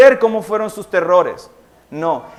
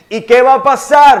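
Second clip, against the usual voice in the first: excited rising question; 6 semitones higher, 10 dB louder than usual. Real, not acted. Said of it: "preacher builds excitement".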